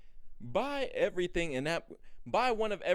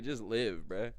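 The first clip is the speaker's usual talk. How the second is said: usual speaking voice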